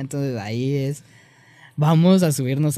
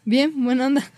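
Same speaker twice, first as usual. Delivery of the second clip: higher, louder, laughing agreement; cheerful casual yes; 9 semitones higher, 4 dB louder than usual. Shown as a demonstration. Answering a friend who asks if he ate, bright laughing yes. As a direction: toss it off happily to a buddy.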